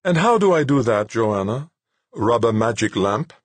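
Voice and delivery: high, reedy, melodious voice; patient, smiling reply. A narrator's reading. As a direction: ask patiently through a smile. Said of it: "He merely smiled patiently and replied".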